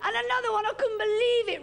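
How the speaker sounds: hammed-up sob, high; mock crying. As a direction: fake weeping while laughing. Mock crying over bad boyfriend.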